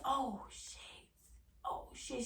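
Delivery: hushed, breathless oh shit under her breath; panicked whisper. Retold or imitated. Client hearing her boyfriend knock, whispering in panic. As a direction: whisper it so the man outside can't hear.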